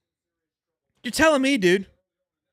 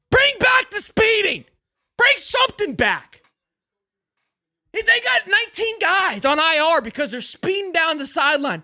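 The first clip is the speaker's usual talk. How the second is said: fired-up shouting; 7 semitones higher than usual. Real, not acted. Streamer shouts that Georgia has depth, worked up. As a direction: shout it like a rallying cry. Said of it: "I'm pissed off".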